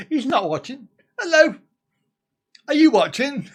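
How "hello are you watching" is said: high, raised hailing voice; calling out. Real, not acted. Host checks if a viewer is watching, calling out. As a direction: hail someone who seems not to be there.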